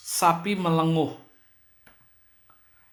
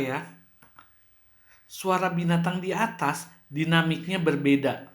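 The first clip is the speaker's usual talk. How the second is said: ordinary talk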